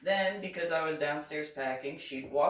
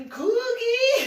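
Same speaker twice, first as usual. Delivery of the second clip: high, loud happy squeal; excited child yell; 8 dB louder than usual. Retold or imitated. Son yelling for cookies, high and loud. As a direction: shout for the cookie like a little boy. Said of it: "James is".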